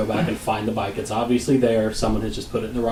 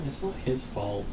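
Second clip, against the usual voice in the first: soft, placating aside; calming; 11 dB quieter than usual. Retold or imitated. Husband calming his angry wife, soft and placating. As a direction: quietly try to settle someone down. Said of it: "trying to keep her calm and saying".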